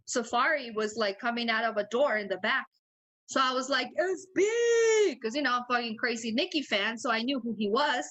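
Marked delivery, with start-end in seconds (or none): 4.0-5.2 a high, loud squeal of a star-struck fan; excited fan shriek. Retold or imitated. Star-struck fan spotting [name]'s bodyguard, high loud squeal. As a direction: squeal it like you just spotted a celebrity.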